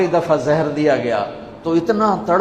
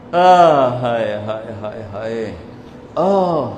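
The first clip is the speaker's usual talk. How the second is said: grieving lament; 6 semitones lower than usual. Acted. Preacher lamenting the Prophet's grandsons, mournful wailing. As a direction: moan the grief aloud, then let it fade.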